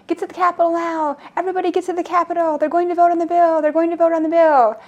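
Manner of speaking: high, held-back imitation of yelling; town crier yell. Man atop a snow pile rallying everybody, imitated town crier yell. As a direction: mimic a town crier raising the alarm, pitched high. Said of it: "just yelling".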